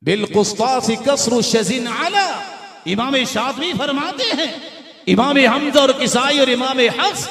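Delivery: high, loud scholarly proclamation; full-force preaching. Real, not acted. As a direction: ring out each citation to a big congregation.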